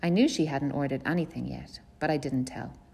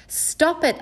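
higher, much louder yell; hollering; 12 semitones higher, 8 dB louder than usual. A narrator's reading. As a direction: scream at them to stop. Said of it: "I hollered".

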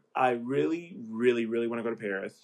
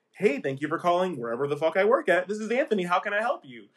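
a lightly higher, polite call-center greeting; customer service phone voice; 7 semitones higher, 3 dB louder than usual. Shown as a demonstration. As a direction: put on the neat phone-job voice for a stranger.

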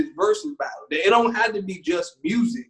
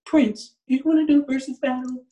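high put-on voice, issuing a challenge; 6 semitones higher than usual. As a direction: challenge a rival in a high pop star impression. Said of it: "[name]'s the type of dude".

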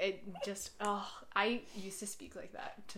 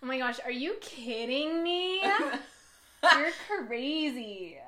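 put-on Valley girl; 5 semitones higher, 9 dB louder, much slower than usual. Mock Valley girl gushing.

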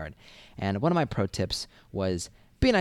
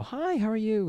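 bright greeting; 13 semitones higher, 3 dB quieter than usual. Retold or imitated. Cheerful greeting to security guard.

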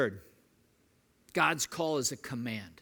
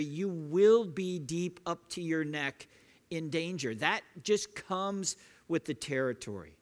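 ordinary talk, nothing marked

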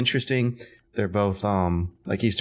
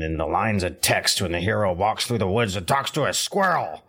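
put-on snooty old author; 4 semitones higher than usual. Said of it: "He says".

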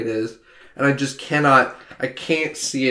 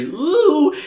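high, rising ghostly "ooh"; mock spooky ooh; 3 dB louder than usual. YouTube ghost hunters playing spooky, high mock ooh. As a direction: hoot like a pretend ghost.

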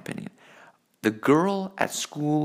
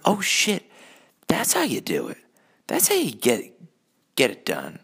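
higher, louder eureka voice; mock excited realization; 5 semitones higher, 4 dB louder than usual. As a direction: play it like a dumb discovery.

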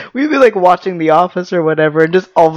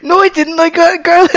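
indignant denial; 13 semitones higher, 3 dB louder than usual. Retold or imitated. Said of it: "And you're like".

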